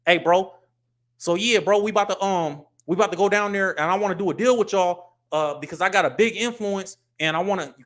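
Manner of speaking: put-on his old street way of talking. Shown as a demonstration. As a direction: pitch a deal like you're on the block. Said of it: "talk to people like this".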